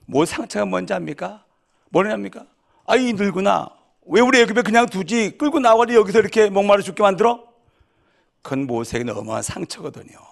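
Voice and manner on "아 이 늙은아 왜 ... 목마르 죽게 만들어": loud, high, bitter grumbling at the leader; angry complaint. Acted. The thirsty crowd berating Moses, angry and loud. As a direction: shout your grievance at the old man.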